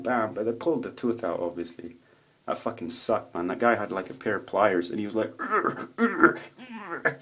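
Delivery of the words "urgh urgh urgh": high-pitched imitated grunt of effort; straining grunt. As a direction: grunt as if tugging hard on pliers. Imitates dentist straining with pliers.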